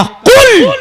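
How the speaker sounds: sudden loud high cry; emphatic proclamation. Retold or imitated. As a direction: burst out high and loud on the one word. Proclaims the Quran's command word. (ऊँची आवाज़ में ज़ोरदार पुकार)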